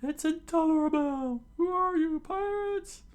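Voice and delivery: loud, very high falsetto outcry; accusing townspeople. Acted. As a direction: accuse in an outraged put-on squeal.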